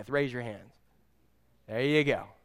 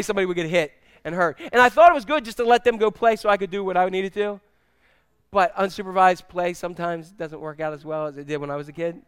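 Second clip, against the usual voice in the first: ordinary talk, nothing marked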